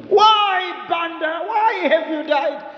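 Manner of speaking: high, loud mock wail at the coffin. Mourner hamming up grief over the coffin, mock wailing. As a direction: wail his name like a hammed-up mourner. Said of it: "he went to hug the coffin".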